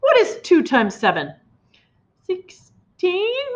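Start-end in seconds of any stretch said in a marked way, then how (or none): 2.3-3.5 put-on high, carefree little-boy guess; small boy answers the headmistress; foolish abandon. A narrator's reading. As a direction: blurt it out happily. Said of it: "with foolish abandon".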